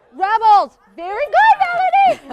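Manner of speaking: high loud praise; host praising Melanie's guess; excited praise. Real, not acted. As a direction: cheer her answer.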